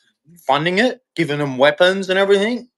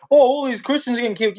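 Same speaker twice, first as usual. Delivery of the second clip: mocking whine; 7 semitones higher, faster than usual. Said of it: "they say".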